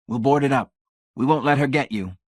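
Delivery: high, loud, emphatic promise; firm assurance. A narrator's reading. Laws promises to keep her from Hamilton, assuring. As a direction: assure him with force. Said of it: "Laws assured him".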